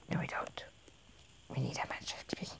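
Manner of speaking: whispered, let down; disappointed whisper. Real, not acted. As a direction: breathe it out softly, deflated.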